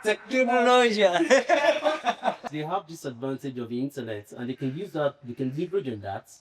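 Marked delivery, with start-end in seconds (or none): 0.0-1.0 high laughing repeat, slow; laughing, drawn out. Real, not acted. 1.1-2.5 high-pitched burst of laughter. Real, not acted. Laughter after joke.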